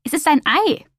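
a hint of crow, rising at the end; croaking crow. A narrator's reading. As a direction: croak it like a crow making an announcement. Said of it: "krächzte die Krähe".

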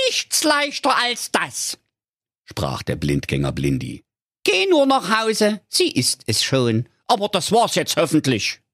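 very high, slangy creature voice; cheeky talking bomb. A narrator's reading. Talking bomb greeting the digger driver, very high cheeky voice. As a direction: greet him like a cocky youngster.

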